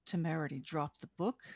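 plain reading voice

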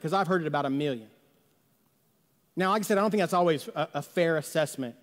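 normal talking voice

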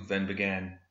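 usual speaking voice

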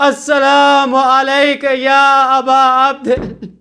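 high, wailing cry of grief; trembling cry. Acted. Son greeting his father's head on a spear, trembling cry. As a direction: cry out to the martyred father, voice breaking. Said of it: "cries out in a trembling voice".

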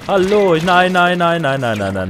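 loud high panicked nein cries; yelling as the enemy closes in; panicked shout. Real, not acted. Enemy chasing him in game. (panisches Rufen)

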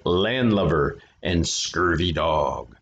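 slow, growled pirate words; pirate talk. Shown as a demonstration. Reader showing kids how pirates say it. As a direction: drag out scurvy dog like a pirate. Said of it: "That's the way you have to say it".

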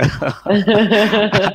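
loud high burst of laughter; hearty laugh. Real, not acted. Host laughs delighted at the American guest, loud high laugh. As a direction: laugh out loud at the Yankees fan.

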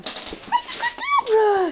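high, slow sing-song praise; drawn-out high coo for a dog. Real, not acted.

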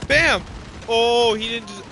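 sudden loud burst of excitement; excited shout. Real, not acted. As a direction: yell it out, thrilled. Sudden kill in game.

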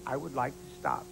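polite imitation. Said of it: "You said that so politely".

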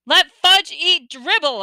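high, loud, drawn-out accusation; screaming. A narrator's reading. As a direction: scream it slowly, word by word. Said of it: "I screamed".

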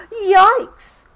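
high, loud startled yelp. A narrator's reading. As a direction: yelp it out in sudden fright. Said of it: "Obie yelped".

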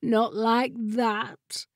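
very slow, sorrowful drawl; mournful. A narrator's reading. The chair confessing its shortcoming to Jessamine, mournfully. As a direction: mourn each word, dragging it out. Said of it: "mourned the chair".

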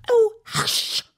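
high, loud, alarmed cry; frightened hush. A narrator's reading. Rabbit frightened Alice will be heard, alarmed. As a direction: panic to silence her. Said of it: "whispered in a frightened tone".

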